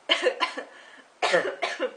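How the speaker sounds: loud cough after laughing; choking cough. Real, not acted. Choking on own silly joke, coughing. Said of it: "Je m'étouffe de mes propres conneries".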